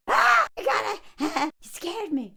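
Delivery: high loud startled cry; mock fright; startled yelp. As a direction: jump at the fright. Startled character retold.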